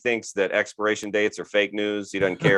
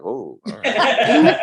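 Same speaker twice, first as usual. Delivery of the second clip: taken aback; 12 semitones higher, 5 dB louder, much slower than usual. Retold or imitated. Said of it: "And I'm like".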